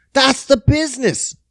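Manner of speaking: "That's the business" pushed up high; high-pitched catchphrase. Acted. Man sums up for viewers, high emphatic catchphrase.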